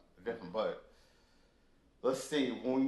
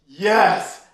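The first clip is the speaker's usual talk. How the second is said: loud, punchy cheer of joy; excited yes; 4 semitones higher, 11 dB louder than usual. Retold or imitated. Heading to Europe for the first time, loud cheer. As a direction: shout it like you just got great news. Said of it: "going to Europe was just like".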